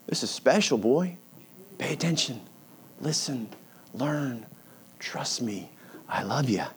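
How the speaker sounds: soft, intimate voice of God to Moses, fading toward a whisper; God tenderly reassuring Moses, dropping almost to a whisper; tender hush. Acted. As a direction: lean in close and speak gently, like a father to his boy.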